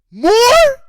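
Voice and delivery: high, loud disbelieving yelp; incredulous. Real, not acted. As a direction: shout the word in disbelief.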